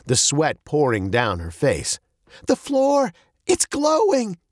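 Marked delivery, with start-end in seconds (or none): 2.5-4.4 high-pitched panicked cry; shrieking. A narrator's reading. Girl sees the floor glowing, high panicked shriek. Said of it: "Veronica shrieked".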